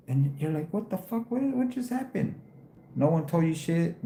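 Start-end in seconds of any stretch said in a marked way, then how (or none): 0.7-2.4 high-pitched stunned what-the-fuck; stunned disbelief. Retold or imitated. Retelling how he was hauled off in court, stunned. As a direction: recreate the shock, pitch jumping up.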